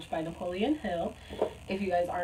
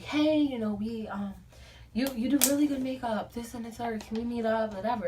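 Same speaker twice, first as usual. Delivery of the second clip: a touch higher, louder saleslady voice; imitating the makeup lady; 5 semitones higher, 4 dB louder than usual. Retelling the lady's DM pitch, light saleslady imitation. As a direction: lightly put on a chirpy recruiter.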